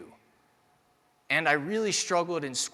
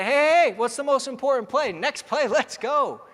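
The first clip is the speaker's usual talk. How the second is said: high, loud "hey, hey, hey" then a fired-up question; a coach firing up a player after a good catch; hyped coach shout; 9 semitones higher, 7 dB louder than usual. Retold or imitated. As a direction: bark it out to snap a player out of complacency. Coach hyping after good play.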